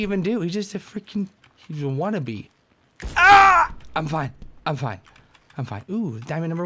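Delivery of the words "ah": very high, loud scream. Real, not acted. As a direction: shriek in fright. Scream in game.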